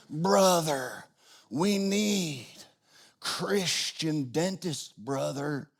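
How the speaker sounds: slow, soft Southern Tennessee drawl imitating a friend; Southern Tennessee accent. Bible college RA Tucker preaching to a late-night debater, slow Southern Tennessee accent. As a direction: lay on a rich Southern Tennessee accent, unhurried. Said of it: "in his rich Southern Tennessee accent".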